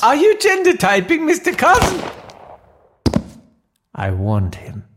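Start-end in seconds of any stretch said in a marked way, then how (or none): 0.0-2.0 executive meeting in a put-on Swedish accent; comic Swedish boardroom voice, rising pitch. Mister Carlson pitching strategy in a Swedish accent. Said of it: "do a funny foreign accent".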